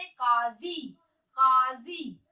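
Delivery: काजी with throaty q and z; slow, drawn-out काजी from the throat; nukta pronunciation. Shown as a demonstration. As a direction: say काजी as qaazi, carefully. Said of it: "क में हमें नुक्ते का उच्चारण".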